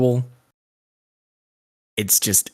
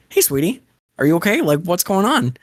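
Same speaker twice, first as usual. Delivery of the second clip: gentle comforting; 9 semitones higher, 3 dB louder than usual. Retold or imitated. Guy comforts little girl.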